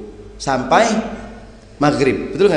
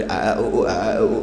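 halting novice reading; 7 semitones lower, much faster than usual. Acted. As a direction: imitate a beginner sounding out letters. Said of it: "yang belum lancar".

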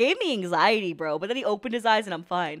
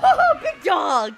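startled laugh; 6 semitones higher, 7 dB louder, much slower than usual. Real, not acted. Startled by huge dog in anime.

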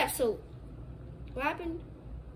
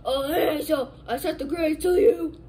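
dad voice; 3 semitones higher, 8 dB louder than usual. Pretend. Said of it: "I'm gonna do a voice as my dad".

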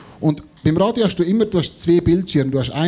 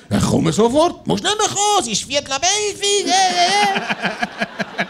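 high, dismissive colleague voice; exasperated colleague; 10 semitones higher than usual. Retold or imitated. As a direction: brush him off impatiently. Colleague replying on the phone.

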